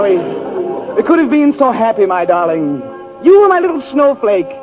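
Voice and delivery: ordinary talk, nothing marked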